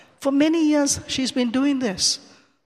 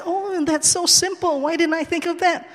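excited realization; 7 semitones higher than usual. Retold or imitated. Speaker realizes her own mistake.

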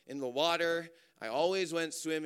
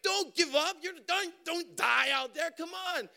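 desperate shout; 11 semitones higher than usual. Retold or imitated. Speaker shouts at his exhausted cousin to keep going, desperate. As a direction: beg him not to give up.

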